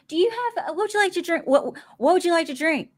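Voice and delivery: lightly voiced server's question; retold New York server. Server asks a Southern visitor for a drink order, lightly imitated.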